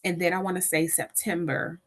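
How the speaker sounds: usual speaking voice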